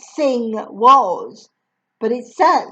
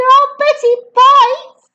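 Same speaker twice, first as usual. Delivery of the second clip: put-on much higher, louder child cry; cried out; 8 dB louder, slower than usual. Lavender crying out to the headmistress, high and loud. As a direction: yell a mischievous warning. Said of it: "cried Lavender".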